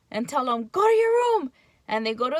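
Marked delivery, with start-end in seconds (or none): 0.7-1.5 high, loud barked order; yelling at child. Retold or imitated. Parent yelling at child sending him away, loud. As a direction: yell it at the kid. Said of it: "we yell at them".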